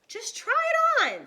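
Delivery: high, loud eager coaxing; eager urging. A narrator's reading. Annie urging Jack to try the hat, high eager coax. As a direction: push him to try it, eagerly.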